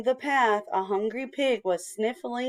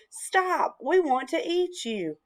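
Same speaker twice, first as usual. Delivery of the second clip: high storybook call, no real volume; mock shout, pitched high; 7 semitones higher than usual. A narrator's reading. Hungry chicks rushing at the ninja bread man, high call.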